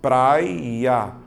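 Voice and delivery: "praia" drawn out into prai-ya; two long, separated syllables in "praia"; split into syllables. Shown as a demonstration. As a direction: exaggerate the break in PRAI-a. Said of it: "duas sílabas".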